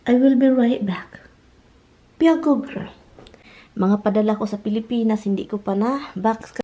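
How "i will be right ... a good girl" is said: whisper. Real, not acted. Owner promising the dog she'll return, whispered. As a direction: breathe it without voice so nobody wakes.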